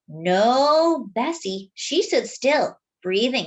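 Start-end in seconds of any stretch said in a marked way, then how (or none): none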